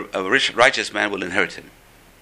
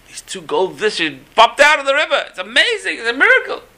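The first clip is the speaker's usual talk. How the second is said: high and loud with amazement; excited; 12 semitones higher, 6 dB louder than usual. Retold or imitated. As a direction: burst out with the news of a miracle. Servant excitedly reports miracle.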